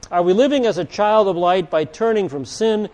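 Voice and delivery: ordinary talk, nothing marked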